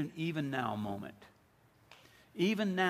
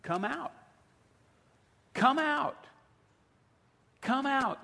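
high, urgent summons, growing louder; calling out; 9 semitones higher, 4 dB louder, slower than usual. Acted. Preacher voices Jesus summoning the congregation, raised call. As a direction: call them out of the tomb, pitch raised. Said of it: "the same voice that called Lazarus".

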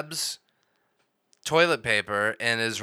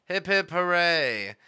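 mock cheer; 7 semitones higher, slower than usual. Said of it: "I like the way that he delivers this".

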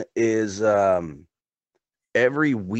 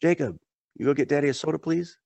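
lightly acted, higher-pitched parent request; dad to toddler; 7 semitones higher than usual. Dad asking his three-year-old son for a soda, lightly imitated higher voice. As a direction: ask sweetly, as if to a small child. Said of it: "my son, you know, he's three".

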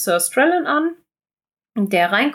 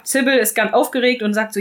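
usual speaking voice

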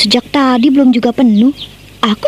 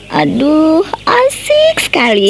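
playful teasing; 5 semitones higher, slower than usual. Acted. Friend teases about romantic meetings.

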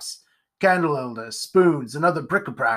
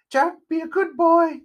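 very high-pitched witchy mimicry; shrieky-high witch impersonation; witchy mother voice; 14 semitones higher, 4 dB louder than usual. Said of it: "in a witchy, high-pitched voice".

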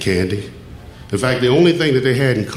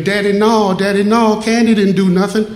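much higher and louder, pleading cry; retold screaming boy; 10 semitones higher, 4 dB louder than usual. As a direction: beg your father to stop, high and loud. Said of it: "the boy was screaming".

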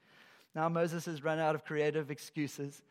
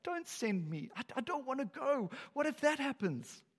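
frozen with fear; 7 semitones higher than usual. Retold or imitated. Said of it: "He's, like, freezing up".

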